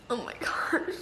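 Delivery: breathy stunned whisper; real overwhelmed whisper. Real, not acted. Overwhelmed by the book's ending, whispering to herself.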